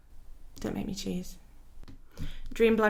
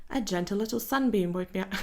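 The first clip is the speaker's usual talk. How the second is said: laughing. Acted.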